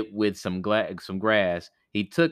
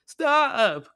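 high, loud ghostly cry; 12 semitones higher, 7 dB louder than usual. Acted. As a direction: shriek stop like a hurt skull. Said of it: "cried the skull".

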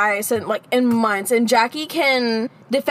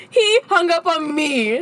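drawn-out, higher indignant complaint; 5 semitones higher, slower than usual. Real, not acted. Telling friend about the guy hanging up, indignant. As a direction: stretch each word in disbelief. Mock outrage at being hung up on.

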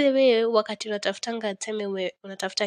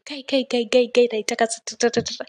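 rapid stuttered syllables, pitched up a little; frantic verbal scatter; scattered mind; 4 semitones higher, 6 dB louder, faster than usual. Acted. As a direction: rattle off repeated sounds like racing thoughts. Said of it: "your mind is scattered".

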